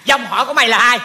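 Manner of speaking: scolding, voice climbing at the end; hot-tempered. Acted. Uncle demands to know who gave birth to the kid, hot-tempered. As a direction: berate the kid with rising temper. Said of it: "mình nóng quá vậy".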